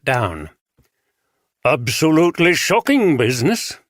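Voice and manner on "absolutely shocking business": put-on bishop with a light northern accent; a hint of a northern bishop, hearty; a touch of a northern accent, raised and firm. A narrator's reading. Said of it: "pronounced traces of a northern accent".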